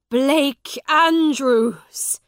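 long, drawn-out joyful shout of a name. A narrator's reading. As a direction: call the name out slowly and loud across the room. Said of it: "shouted Miles".

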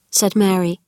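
plain reading voice

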